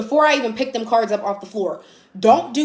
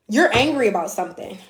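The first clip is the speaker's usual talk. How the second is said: ordinary talk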